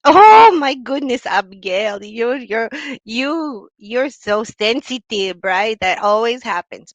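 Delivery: aunt laughing off the complaint, loud and drawn out; dismissive aunt. Retold or imitated. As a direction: brush off the niece as oversensitive. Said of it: "you know what they're gonna say".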